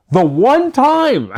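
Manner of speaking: high, loud, slow stress on "the one time"; "the one time" stretched out, high and loud; emphasized. Real, not acted. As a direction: lean hard on "the one time", drawn out. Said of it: "let me emphasize".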